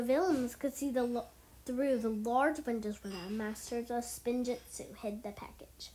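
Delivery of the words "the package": unvoiced whisper. A narrator's reading. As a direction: breathe the words out with no voice.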